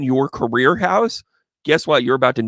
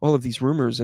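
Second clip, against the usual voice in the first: usual speaking voice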